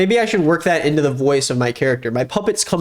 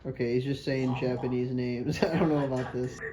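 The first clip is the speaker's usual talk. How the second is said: ventriloquist voice; 9 dB quieter than usual. Shown as a demonstration. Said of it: "read it with my ventriloquist voice".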